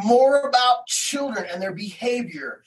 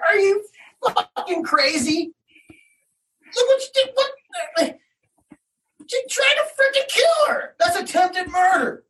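retold furious yelling; 11 semitones higher than usual. Father confronting the boy's mother, outraged shouting. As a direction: yell it at the woman who did it.

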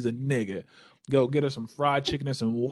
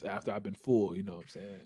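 ordinary delivery

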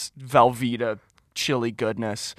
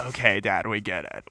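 lower, softer, tired of the joke; weary; 6 semitones lower, 7 dB quieter than usual. Retold or imitated. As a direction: sigh it at your dad. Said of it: "I'm like".

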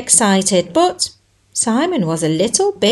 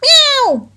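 high, loud meow; hammed-up cat meow; 5 dB louder than usual. The cat meows.

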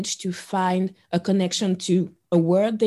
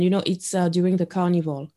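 normal talking voice

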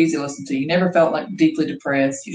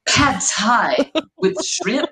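slow, put-on gasp of delight; playing along with pretend menu; 5 semitones higher, much slower than usual. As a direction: draw out the order in a higher play-along voice. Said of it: "she'd have to be like".

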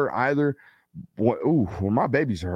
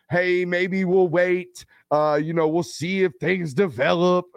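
higher, slow mock-hesitant parent voice; 7 semitones higher, slower than usual. Dad mocking wait-and-see parents, slow mock voice. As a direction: parody a dithering parent. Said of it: "Ain't no".

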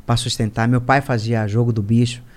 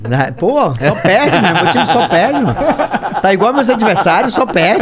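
laughing; 6 semitones higher, 6 dB louder, slower than usual. Real, not acted. Joking his team only loses.

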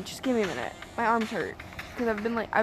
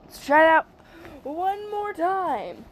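high, loud, dragged-out "one more time"; rallying for another go; pumped up; 6 semitones higher, 3 dB louder, slower than usual. Real, not acted. Gamer urging herself on before retrying the level, pumped.

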